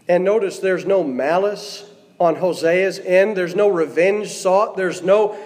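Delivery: ordinary talk, nothing marked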